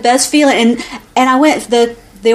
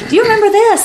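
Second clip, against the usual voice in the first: asking mama; 10 semitones higher, faster than usual. Retold or imitated. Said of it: "I keep telling my mama".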